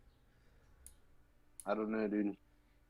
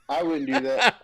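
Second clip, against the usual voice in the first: much higher and louder, knowing warning; mock warning; 13 semitones higher, 8 dB louder than usual. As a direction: play the expert who already knows. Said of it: "And I'm like".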